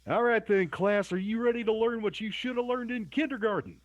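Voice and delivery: high barking drill sergeant voice; drill instructor. Pretend. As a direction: bark orders at the cadets. Host plays drill instructor to class.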